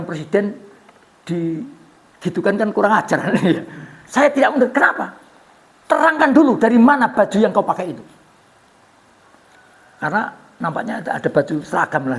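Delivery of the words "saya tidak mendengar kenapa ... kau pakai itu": loud, higher demand; challenging. Acted. Salman demands Umar explain his shirt, loud. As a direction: press him hard.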